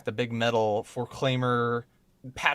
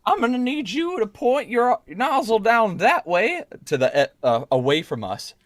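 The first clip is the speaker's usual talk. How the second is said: character voice; 8 semitones higher than usual. Acted. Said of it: "I say".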